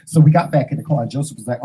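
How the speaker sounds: ordinary talk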